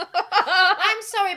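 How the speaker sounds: high, loud, laughing through the words; cracking up while apologising; laughing apology. Real, not acted. To the listeners, laughing too hard to talk.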